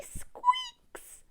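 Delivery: very high dolphin squeak. Acted. Showing children how a dolphin speaks, squeaky. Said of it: "that's how a dolphin speaks".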